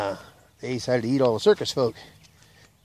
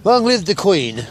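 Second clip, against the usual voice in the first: put-on loud, slow, high English-accented toast; 7 semitones higher, 7 dB louder, slower than usual. Englishman's last words, loudly proclaimed in an English accent. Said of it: "They go to the Englishman".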